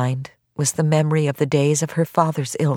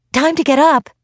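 put-on high, louder girl's voice; higher and louder, waking someone up; young girl's wake-up call; 11 semitones higher, 5 dB louder than usual. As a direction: call out to rouse a sleeping granny. Girl waking her granny.

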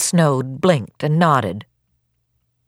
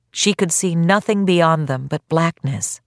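ordinary delivery, nothing marked

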